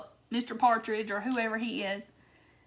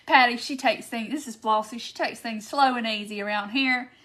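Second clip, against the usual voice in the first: usual speaking voice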